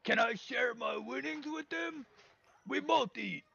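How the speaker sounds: high, slow, strained ogre plea; drawn-out high creature voice, eager to share. Acted. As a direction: ask generously as a simple ogre. Ogre asks announcer to share food.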